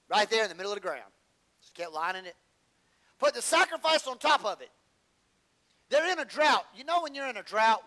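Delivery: loud, high sermon delivery; preaching at full force. Acted. Preacher telling the church about the drought, loud and high. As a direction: preach it out to the pews.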